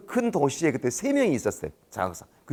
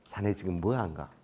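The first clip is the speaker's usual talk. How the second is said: lower, softer reproving question; low-key boss; 7 semitones lower, 8 dB quieter than usual. Retold or imitated. Senior officer questioning rookie, quiet reproof.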